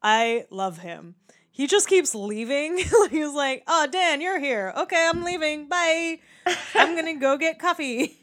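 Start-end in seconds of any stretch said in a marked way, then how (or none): 3.7-8.2 lightly voiced guard, pitched up; breezy imitation of a departing guard; security guard. Security guard excusing himself from Dan, lightly imitated in higher pitch. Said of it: "shout out must be for the security guard".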